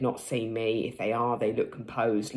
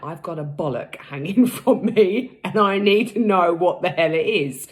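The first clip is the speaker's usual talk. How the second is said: loud, laughing panic about the lump; panicky loud self-quote; 5 semitones higher, 9 dB louder than usual. Retold or imitated. Telling her viewers how she panicked, loud and half laughing. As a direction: blurt it out in mock alarm, half laughing. Urgent retold self-talk over boil.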